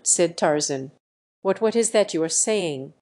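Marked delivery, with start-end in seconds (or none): none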